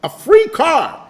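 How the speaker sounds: put-on high-pitched kid's amazement; excited kid. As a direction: imitate a wowed kid, stretching the last words. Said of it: "I was, I was a kid".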